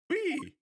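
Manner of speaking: put-on high excited squeal of joy, French accent; gleeful whee. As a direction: let out a joyful high whee. Riding off on motorcycle.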